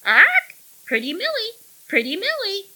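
very high, loud squawking parrot. A narrator's reading. Parrot taunting Millie, high squawk. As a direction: squawk it with gleeful spite. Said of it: "The bird seemed to watch with malicious glee".

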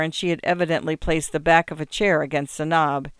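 ordinary delivery

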